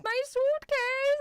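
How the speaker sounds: high, loud, drawn-out mock wail; slow hammed-up cry in a child's pitch; Baron Trump. Imagining a kid's famous suitcase line, mock wail. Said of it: "I'm just imagining the Baron Trump's".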